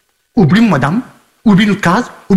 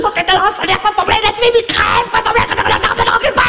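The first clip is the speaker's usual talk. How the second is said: much higher, hoarse shouted rage; angry yelling; 11 semitones higher than usual. Acted. Man yelling much higher during a fight. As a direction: scream at them, furious.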